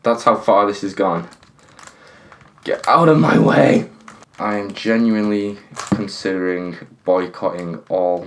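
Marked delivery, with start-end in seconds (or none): 2.6-3.9 higher, louder angry yell; frustrated shout. Real, not acted. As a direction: bark it at the stuck piece. Yelling at the stuck puzzle.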